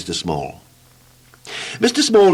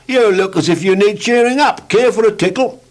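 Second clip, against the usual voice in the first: high, louder, cheery character voice; cheery Mister Tickle; 11 semitones higher, 5 dB louder than usual. Acted. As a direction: bounce in with a high bright hello.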